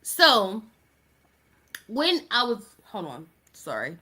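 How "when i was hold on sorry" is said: catching a choke mid-sentence; choking on spit. Real, not acted. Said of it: "I swallowed my spit the wrong way".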